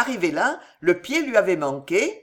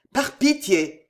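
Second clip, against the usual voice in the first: higher pleading cry; a sudden raised plea; cried out; 6 semitones higher, slower than usual. A narrator's reading. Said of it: "cria-t-il".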